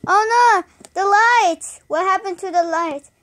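alarmed. Acted. Lights go out.

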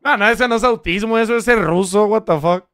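ordinary talk

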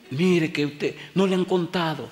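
high, anxious woman's voice; an alarmed lady warning the pastor; woman pleading. Retold or imitated. Lady urgently warns pastor away from sick son, high pleading.

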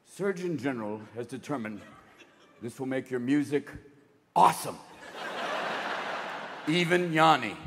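low, official announcer voice; mock solemn public-service announcement; warning label read. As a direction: read it like a deadpan government warning. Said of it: "It's gonna have to say".

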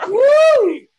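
high, loud whoop. Real, not acted. Signing off the set with a whoop. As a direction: cheer the crowd goodbye. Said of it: "closing cheer".

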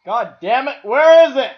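loud, rising frustrated yell; real annoyance boiling over; angry outburst. Real, not acted. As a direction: curse it out loud at the room. Frustrated search.

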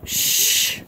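ordinary delivery

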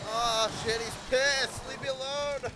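yelling in fright; panicked shout. Real, not acted. Monster chasing, shouting.

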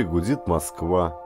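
ordinary delivery

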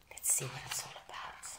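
hushed aside before the story; soft excited whisper. Real, not acted. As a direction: whisper it like a secret to the listeners. Intro before reading the story.

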